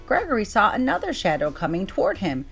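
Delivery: ordinary delivery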